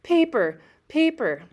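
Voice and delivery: high, loud delighted shout; high loud cry of glee; wild excited cry. A narrator's reading. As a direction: cry it out gleefully like a kid. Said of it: "He cried".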